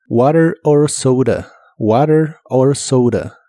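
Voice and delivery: "Water or soda" slowly, flapped t and ou in soda; "water or soda" lento; slow pronunciation demo. Shown as a demonstration. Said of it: "se suele pronunciar como R".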